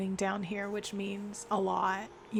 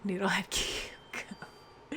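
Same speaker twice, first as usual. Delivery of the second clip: giggled; 4 dB quieter, slower than usual. Real, not acted. Giggling nickname.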